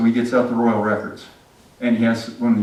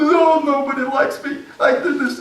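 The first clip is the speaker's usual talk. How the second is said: high, whiny sulking voice; pouting Haman. Acted. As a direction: sulk like a spoiled child. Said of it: "he pouts against the wall".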